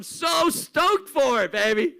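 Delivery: slow, loud, high stoked shout; excited shout. Real, not acted. Host hyping the festival.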